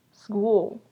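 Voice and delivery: "School" starting low and rising high; "School" rising like a question; rising tune. Shown as a demonstration. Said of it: "The S sounds in low pitch, and then it goes to a high pitch".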